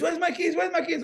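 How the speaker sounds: frantic searching. Retold or imitated. Searching for keys.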